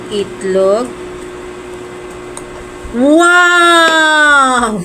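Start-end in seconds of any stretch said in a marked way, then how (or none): 2.9-4.8 loud, high delighted gasp of wow; excited wow. Real, not acted. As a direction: burst out in real delight. First taste of hot noodles.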